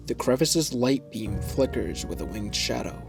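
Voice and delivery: normal talking voice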